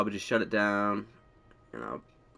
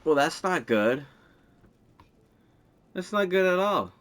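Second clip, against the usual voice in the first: rising alarm, louder and higher; 8 semitones higher, 6 dB louder than usual. Real, not acted. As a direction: panicky "oh no" reaction. Gear result goes wrong.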